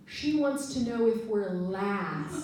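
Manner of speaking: slow, British "last" for lost; British colleague. Retold or imitated. Colleague explaining the girl's accent, slow British. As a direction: say lost the English way, slowly. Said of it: "my colleague turns to me and says".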